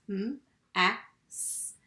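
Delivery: "m, a, s" as separate letter sounds; sounded out. Shown as a demonstration. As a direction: say each sound of "m, a, s" on its own, short vowel. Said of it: "I would sound out first".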